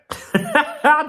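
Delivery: high, loud burst of laughter; cheeky laugh. Real, not acted. Vlogger amused at his own comeback, loud laugh.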